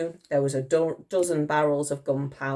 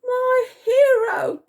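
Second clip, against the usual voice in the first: high, loud, drawn-out gush; swooning cheer; 8 dB louder, much slower than usual. A narrator's reading. Dotty admiring the soldier, gushing cry. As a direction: gush slowly in delight. Dotty gushes over Titch.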